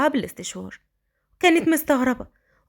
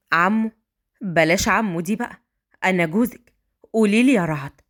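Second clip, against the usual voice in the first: put-on a grown man talking kindly to a child; gentle man voice; 6 semitones lower than usual. A narrator's reading. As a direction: lower affectionate man's voice. Raad corrects.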